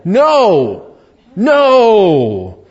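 loud, forceful preacher's denial; emphatic no. Real, not acted. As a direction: thunder it from the pulpit. Preacher rejecting a false teaching.

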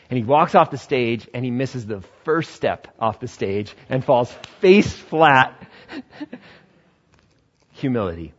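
normal talking voice

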